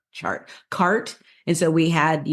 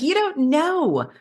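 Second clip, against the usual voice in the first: playful retort; 11 semitones higher, slower than usual. Retold or imitated. Retold retort to the bartender.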